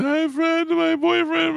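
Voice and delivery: very high, squeaky mock sob voice; mock a drunk girl blubbering in a falsetto squeak; imitating crying girl. Bouncer mocking a crying girl in a squeaky high voice. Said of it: "You're like".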